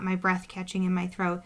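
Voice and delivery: ordinary delivery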